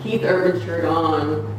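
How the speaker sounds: ordinary talk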